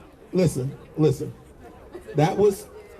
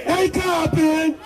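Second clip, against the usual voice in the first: shouted cheer; 11 semitones higher, much slower than usual. Acted. Rally cheer call outs.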